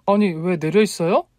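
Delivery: high, flustered question; taken aback. A narrator's reading. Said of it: "당황해서 물었죠". (당황해서 높은 목소리로 묻기)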